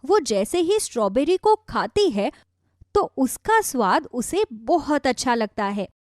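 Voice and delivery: usual speaking voice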